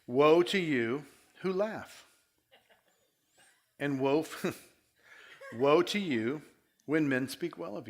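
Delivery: each woe spoken slowly with pauses; a laugh breaking into the last woe; slow recap reading. Shown as a demonstration. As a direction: let every woe land slowly. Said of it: "paint a picture of recap".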